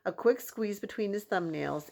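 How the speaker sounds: ordinary delivery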